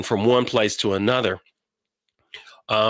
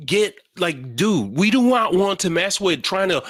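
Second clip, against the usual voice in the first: put-on higher, exasperated lecture to the kid; exasperated dad; 7 semitones higher than usual. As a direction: talk her down, fed up. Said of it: "put me in a mode where I'm like".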